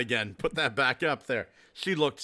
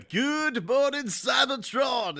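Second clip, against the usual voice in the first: high, slow imitation of the show greeting; mimicking greeting; 8 semitones higher, slower than usual. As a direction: stretch out Cybertron like the birthday boy. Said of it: "whenever you hear him go".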